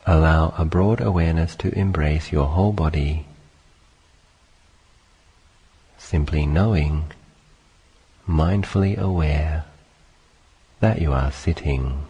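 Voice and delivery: calm, unhurried meditation voice with long pauses; guided meditation. Acted. Teacher leading listeners through sitting and breathing, calm guided meditation. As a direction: guide listeners gently into stillness, phrase by phrase.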